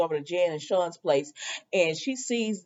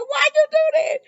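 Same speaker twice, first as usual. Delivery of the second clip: very high-pitched mock protest; mock outcry; 4 dB louder, faster than usual. Recapper voicing Queen's protest to Abigail, mock outcry. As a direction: squeal it as an imitated complaint. Acting Queen's indignant question.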